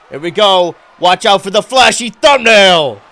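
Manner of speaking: loud, high ringside shout; wrestling commentator. Acted. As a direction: yell it like a hype announcer. Hyped play-by-play shout.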